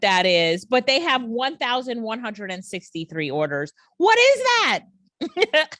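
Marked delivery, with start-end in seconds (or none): 4.0-5.8 high, slow, incredulous question; squeaky high what is that; baffled. Real, not acted. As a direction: stretch it out in disbelief. Baffled by a seller's clip.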